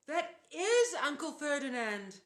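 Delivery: high, loud, slow horrified gasp; gasped in horror. A narrator's reading. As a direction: blurt the realization in terror. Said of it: "Grover gasped".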